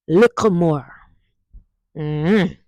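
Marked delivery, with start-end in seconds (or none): 0.1-0.9 the double T in "Little more" becomes a K, "likka more". Shown as a demonstration. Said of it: "make we say all three of them now".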